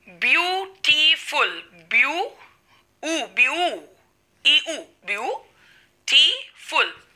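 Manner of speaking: "ब्यूटीफुल" slowly split into ब्यू, टी, फुल, vowels stretched; syllable-by-syllable demonstration. Shown as a demonstration. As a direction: count out the vowel sounds in "ब्यूटीफुल", drawing each one out. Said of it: "syllable कैसे count करते". ("ब्यूटीफुल" को धीरे-धीरे, syllable तोड़कर बोलना)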